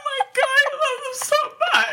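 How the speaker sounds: very high, loud squeal with laughter; overwhelmed squeal. Retold or imitated. Reliving crying in the theater, high, loud laughing squeal. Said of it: "I was like".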